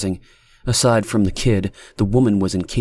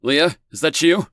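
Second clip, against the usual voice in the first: held-back shout; 7 semitones higher than usual. A narrator's reading. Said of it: "trying not to shout into the receiver".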